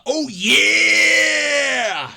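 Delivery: loud, slow mock Oh yeah; hammed-up wrestler bellow; wrestler roar. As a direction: drag out the catchphrase like a promo. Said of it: "the battle of the".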